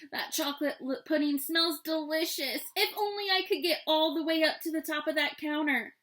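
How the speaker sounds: Goldilocks. A narrator's reading. Goldilocks longing for pudding on the counter, high girlish voice. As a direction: pitch it up like a wishful child. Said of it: "said Goldilocks".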